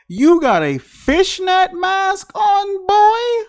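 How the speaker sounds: high, slow, incredulous roast; incredulous mock. Real, not acted. Roasting the movie killer's fishnet mask, incredulous and high.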